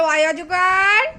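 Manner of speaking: slow, loud teaching chant; slow loud recital. Shown as a demonstration. Mother recites for child, slow and loud. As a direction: spell it out slowly and loudly for the child. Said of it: "বলো".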